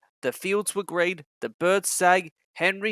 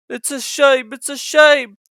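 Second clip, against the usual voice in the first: indignant, higher and louder; hissed; 9 semitones higher, 7 dB louder than usual. A narrator's reading. Henry hissing about the boys, indignant. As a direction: hiss it in outrage. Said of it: "hissed Henry".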